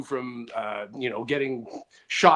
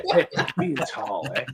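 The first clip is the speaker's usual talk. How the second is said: guys' comeback; 3 semitones lower than usual. Retold or imitated. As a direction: drag it out, teasing, a touch mock. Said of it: "every time guys will go".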